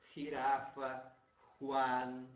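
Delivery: girafa and Juan said loud with a throaty Spanish start; Spanish j sound demo. Shown as a demonstration. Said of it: "aquele mesmo do G".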